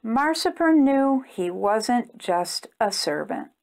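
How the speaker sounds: usual speaking voice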